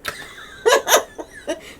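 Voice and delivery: high breathy burst of laughter; can't hold back a laugh at the clip; airy laugh breaking out; real laugh. Real, not acted.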